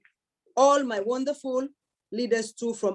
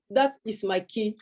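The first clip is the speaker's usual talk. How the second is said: normal talking voice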